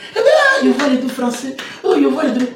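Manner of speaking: high squealed mimicry; mock exclamation. Speaker mimics someone exclaiming over French, high mock squeal.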